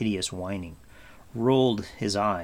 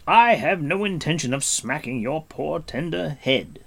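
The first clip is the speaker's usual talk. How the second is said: raised, stern prince voice; dry and commanding; Gwydion's voice; 6 semitones higher, 7 dB louder than usual. A narrator's reading. Gwydion warning Gurgi to stop whining, stern and raised.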